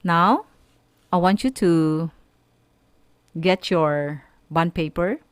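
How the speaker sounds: ordinary delivery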